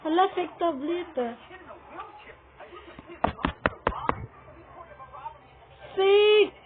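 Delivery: loud high shouts; alarmed shout. Real, not acted. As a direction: cry out in alarm. Yelling in alarm.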